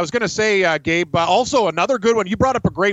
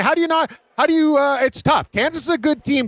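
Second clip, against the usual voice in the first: raised, eager voice; excited; 8 semitones higher than usual. Real, not acted. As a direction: gush about the pick. Excited pick of West Virginia.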